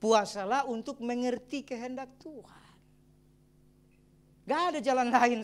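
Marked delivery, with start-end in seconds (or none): 0.0-2.7 slow, weighty statement of the point; slow emphatic. Shown as a demonstration. Pastor stating the last point, slow and emphatic. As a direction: lay it down slowly and firmly. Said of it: "ini yang poin terakhir".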